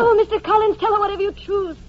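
high, exasperated outburst, loud at the start. Acted. Elizabeth to her cousin pressing her about dinner, exasperated.

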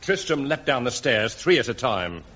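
ordinary talk

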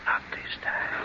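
slow eerie ghostly whisper. Acted. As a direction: breathe it out slowly. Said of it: "why are you whispering".

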